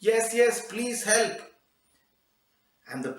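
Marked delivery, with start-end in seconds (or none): 0.1-1.4 put-on high, louder girl's cry from below; calling out. Trapped girl answers from below, calling out. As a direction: cry up for help from a pit. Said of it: "a voice of a girl calling out".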